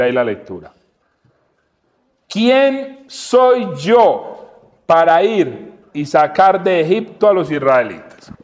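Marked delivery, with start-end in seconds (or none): 2.3-8.3 slow solemn reading. Acted. Preacher reads Moses' words aloud.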